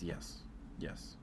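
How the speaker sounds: usual speaking voice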